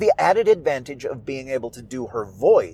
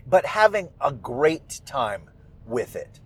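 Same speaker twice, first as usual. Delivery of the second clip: ordinary delivery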